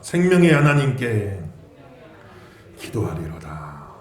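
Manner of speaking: slow, measured recitation of 기도하리로다 for all to repeat. Shown as a demonstration. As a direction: lead the line for the congregation to echo. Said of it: "따라합시다".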